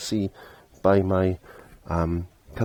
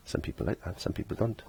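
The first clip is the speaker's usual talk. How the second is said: half-whispered aside, almost voiceless; playful whisper; 14 dB quieter than usual. Real, not acted. To viewers about his constant talking, a playful whisper. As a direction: let it slip out as a soft whispered joke.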